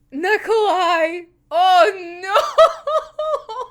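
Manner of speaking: delighted despair; anguished fan cry. Real, not acted. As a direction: wail his name in dismay. Fan reacting to a character's entrance.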